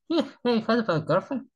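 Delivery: high mock dad voice asking a question; imitating his father. Imitating father quizzing his son about a girlfriend, high and mock. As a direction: pitch it up like a teasing father. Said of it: "My father just say".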